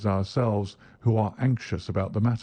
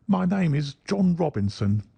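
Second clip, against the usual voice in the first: nervous lie; 7 semitones higher than usual. A narrator's reading. The man lying nervously.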